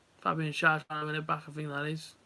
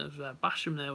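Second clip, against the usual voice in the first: normal talking voice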